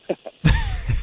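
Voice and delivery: a sudden high laugh that breaks out; real laugh, bursting out high; burst of laughter. Real, not acted. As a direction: crack up at the absurd memory. Laughing at his own memory.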